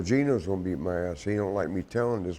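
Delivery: ordinary talk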